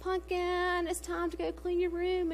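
high, soft singsong coaxing; exaggeratedly gentle, high-pitched sweet talk; sugary sweet mom. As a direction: coo it sweetly, as to a little one. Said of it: "would you rather me say".

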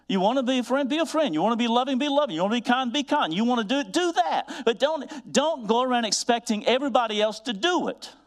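high, fervent fatherly exhortation; soaring, insistent preacher's push; daddy moment. Real, not acted. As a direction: preach it like a dad laying down the law. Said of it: "That's my daddy moment".

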